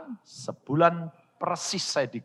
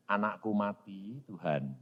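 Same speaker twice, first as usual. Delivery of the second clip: low, soft lament to God; hushed acted grief; subdued prayer; 6 semitones lower, 10 dB quieter than usual. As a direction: say it low, resigned before God. Acted prayer reporting child's death.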